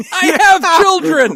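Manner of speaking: high, loud, laughing yell; laughing shout. Real, not acted. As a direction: blurt it out while cracking up. Mock outrage, laughing hard.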